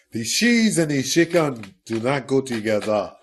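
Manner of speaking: a quick party-trick French accent, played for laughs. Shown as a demonstration. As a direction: ham up a French chef's accent. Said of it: "I learned how to do a little, uh, French accent".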